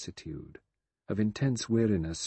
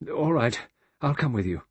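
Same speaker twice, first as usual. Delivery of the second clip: raised, desperate giving-in; 5 semitones higher, 5 dB louder than usual. A narrator's reading. Vera gives in to going along, desperate. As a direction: give in loudly on the first words, then drop. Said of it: "Vera said desperately".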